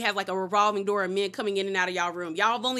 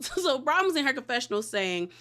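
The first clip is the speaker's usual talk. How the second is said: ordinary talk, nothing marked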